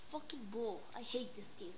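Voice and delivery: ordinary talk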